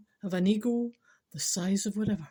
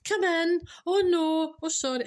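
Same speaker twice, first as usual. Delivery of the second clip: high, raised sing-song call turning flustered; cheery shout; 9 semitones higher, 4 dB louder than usual. Acted. Woman answering a toilet knock by mistake, cheery shout. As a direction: call out high, then apologise in dismay. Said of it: "And you shout".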